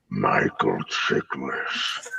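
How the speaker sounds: very low, drawn-out whispery murmur; creepy yoga voice. Acted. Sneaking up behind a friend in hot yoga, creepy low murmur. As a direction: drag it out deep and soft, barely voiced. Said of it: "go up behind him and go".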